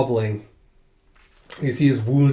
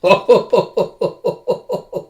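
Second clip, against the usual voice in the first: high laughter in a long run; real gleeful cackle; laughing; 7 semitones higher, 4 dB louder than usual. Real, not acted. Reader reacting to the kiss, laughing.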